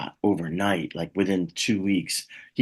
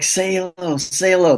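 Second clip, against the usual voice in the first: excited fans; 9 semitones higher, 5 dB louder than usual. Retold or imitated. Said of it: "They're like".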